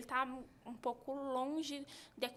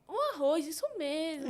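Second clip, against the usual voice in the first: put-on encouraging a baby; 3 semitones higher, 6 dB louder than usual. Mother praising her son at mealtime, lifted and louder. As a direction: cheer a little one on as he eats. Naming foods for baby while feeding.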